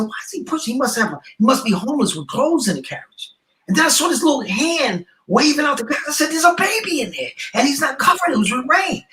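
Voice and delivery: rising, alarmed realization; spotting the baby in the rain, voice climbing high; alarmed surprise. Retold or imitated.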